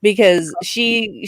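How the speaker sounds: ordinary delivery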